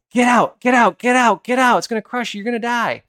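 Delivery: high, urgent mock screams of alarm; retold screaming. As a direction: act out a panicked crowd yelling warnings. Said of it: "people around started screaming".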